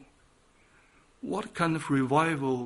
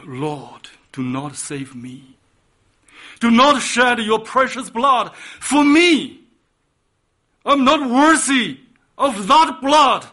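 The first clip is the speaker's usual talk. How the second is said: pleading penitent; 7 semitones higher, 8 dB louder than usual. Retold or imitated. A repentant Peter crying out to the Lord. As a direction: beg not to be saved, rising to a cry. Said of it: "We would say".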